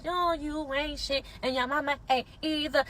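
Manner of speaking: slow, drawn-out lyric quote; quoting his lyric. Retold or imitated. She quotes his diss track lyric about her mom, slowly. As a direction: drag out his line, half-mocking. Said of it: "your diss track about how my mom is".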